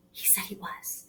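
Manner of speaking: excited hushed whisper after a gasp. Pretend. Teacher whispering to the class about Amir: hushed whisper. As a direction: share the news in a secret whisper.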